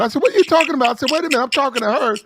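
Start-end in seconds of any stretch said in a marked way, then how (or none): 0.2-0.9 high-pitched incredulous comeback; acted-out indignant question; retold disbelief. Retold exchange, his startled comeback. 1.1-2.2 high, quick indignant protest; worked-up retelling of an argument; retold indignation. Acting out his confrontation with her.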